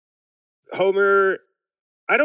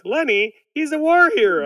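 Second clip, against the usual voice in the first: much higher and louder, a squealing protest; Lenny protest; 9 semitones higher, 6 dB louder than usual. Retold or imitated. Lenny pleading with Burns, in a much higher louder squeal. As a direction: squeak out the objection. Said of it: "He's like".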